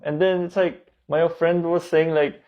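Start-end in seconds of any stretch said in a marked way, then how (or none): none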